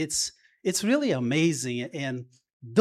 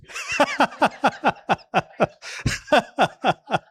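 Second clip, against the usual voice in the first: real high burst of laughter; amused chuckling laugh; hearty laugh; 10 semitones higher than usual. Real, not acted. Laughs at own joke.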